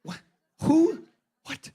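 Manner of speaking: baffled one-word questions, one jumping up high; stunned double-take in short bursts; mock bewilderment. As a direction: reenact being thrown by the news. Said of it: "I heard that the first time. I went".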